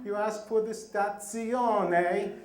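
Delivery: stazione drawn out slowly, with a hammed-up Italian accent; bad Italian accent. Shown as a demonstration. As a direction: stretch stazione like a tourist faking Italian. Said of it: "the worst Italian accent you can think of".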